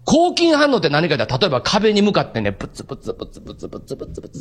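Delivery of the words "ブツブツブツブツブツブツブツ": low whispered mumbling at the wall; muttering to oneself. Shown as a demonstration. Preacher imitating an isolated inmate muttering at the wall, whispered. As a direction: mumble under your breath like a lonely prisoner. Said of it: "ずーっと長く話し出すの".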